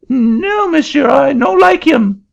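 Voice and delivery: put-on very high, loud French-accented protest; an indignant Frenchman in a shrill-high French accent; angry French accent. Frenchman refusing the auctioneer's seat, high loud French accent.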